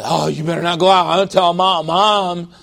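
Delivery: put-on a snitching child threatening to tell Mom; lightly voiced rules kid; tattletale kid. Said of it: "you always get the rules kid, who said".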